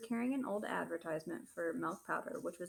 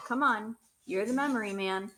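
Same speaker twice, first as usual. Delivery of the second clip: loud, sarcastic pressing; angry goading; 2 semitones higher, 8 dB louder than usual. Acted. Father taunts Christopher as memory man, loud and angry. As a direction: needle him loudly.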